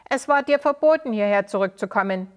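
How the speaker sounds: angry shout. Acted. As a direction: shout it across the crowd. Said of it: "rief einer der Männer".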